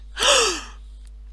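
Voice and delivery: high, sharp shocked gasp; a sudden gasp of shock; a child's loud startled gasp. Acted. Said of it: "Deborah Jo gasped".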